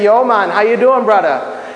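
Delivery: imitated Jamaican greeting; Jamaican accent. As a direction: put on a rough Jamaican lilt. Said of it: "if my Jamaican friend say".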